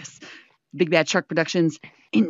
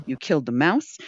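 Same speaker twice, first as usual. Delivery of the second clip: ordinary talk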